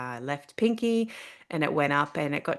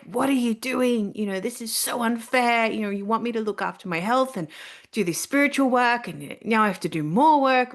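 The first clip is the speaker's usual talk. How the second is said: yelling at the universe; 7 semitones higher, 3 dB louder than usual. Retold or imitated. Alone on a rock near home, yelling at the universe, angry. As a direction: rant at the universe as if it has betrayed you. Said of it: "I was yelling at the universe".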